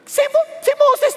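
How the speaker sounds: high, drawn-out excuse; protesting Joshua. Acted. Joshua pleading with God about Moses, protesting. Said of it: "He was saying, "Lord".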